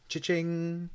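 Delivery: high, loud imitated till ring; cash-register cha-ching. Quiz host awarding everyone five points, cash-register cha-ching.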